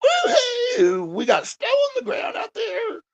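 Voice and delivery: woo-hoo shouted way up high; excited whoop. Retold or imitated. Streamer whooping to viewers about the snow. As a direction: whoop like a kid seeing snow. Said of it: "me going".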